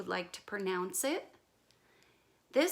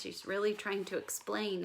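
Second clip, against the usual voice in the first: ordinary delivery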